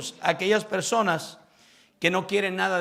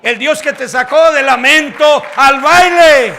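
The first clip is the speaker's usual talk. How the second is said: building preaching; 6 semitones higher, 13 dB louder than usual. Acted. Pastor praising God before the congregation, building to a shout. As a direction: pile each phrase higher until the cry.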